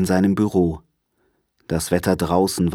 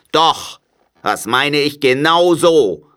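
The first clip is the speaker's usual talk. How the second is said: higher, cranky miser's retort; crotchety uncle digging in; grumpy Scrooge, insistent; 7 semitones higher, 5 dB louder than usual. A narrator's reading. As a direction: insist on it crossly. Scrooge snaps back at nephew.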